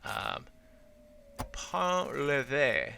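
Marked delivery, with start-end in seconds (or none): 1.5-2.9 "Painlevé" drawn out very slowly, "PAH-luh-vay", falling; slow careful try. Shown as a demonstration. To viewers, carefully sounding out the name, slow. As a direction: spell "Painlevé" out syllable by syllable. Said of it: "pronouncing it wrong".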